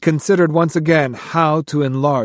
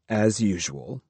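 usual speaking voice

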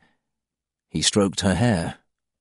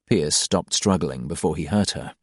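usual speaking voice

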